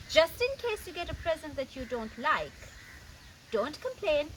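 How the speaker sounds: ordinary delivery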